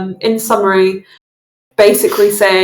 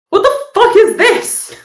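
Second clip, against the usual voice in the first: high, incredulous outburst; outraged; 12 semitones higher, 4 dB louder than usual. Acted. Game master as a man finding a gift in his room, outraged. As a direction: burst out in acted outrage, pitch shooting up. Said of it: "storms over to his room".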